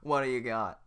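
loud barking guard voice; a gruff guard demanding. Acted. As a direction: bark it like a bored official. Reading Shrek script, guard role.

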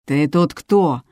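plain reading voice

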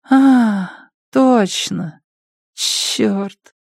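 high, dazed junkie voice; stoner. Acted. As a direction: remember slowly, high and foggy.

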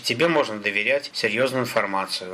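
plain reading voice

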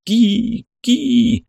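high piercing bird cry. A narrator's reading. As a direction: shriek it like a hawk. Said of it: "смех, похожий на крик".

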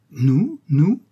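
feigned innocence. A narrator's reading. Second schoolboy echoing his brother, surprised innocence. Second boy echoes the denial.

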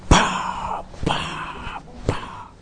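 three spaced, voiceless "pa" slap noises; popped slap sounds, fading after the first; imitated slaps. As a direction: imitate a toddler's repeated smacks. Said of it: "le estaba tirando un golpes".

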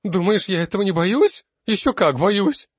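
high, loud panicky cry; frightened outcry. A narrator's reading. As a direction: blurt out the panic. Frightened student keeps crying out. (испуганный высокий громкий крик)

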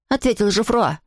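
ordinary delivery

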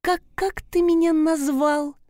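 high, sugary mock-sweet question; sweetly. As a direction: ask it sweetly with a hidden threat. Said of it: "Ласково уточнила".

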